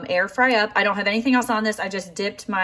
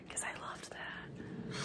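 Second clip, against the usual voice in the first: breathy unvoiced whisper; whispered aside; 22 dB quieter, slower than usual. Real, not acted. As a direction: let it slip as a secret.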